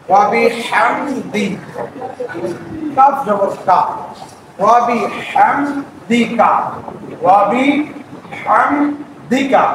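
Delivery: ওয়া বি হামদিকা with হা pressed from the throat, slow; slow spelled recitation. Shown as a demonstration. Teacher spelling out the phrase slowly. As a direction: build ওয়া বি হামদিকা syllable by syllable. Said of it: "হা কণ্ঠনালীকে চাপ দিয়ে".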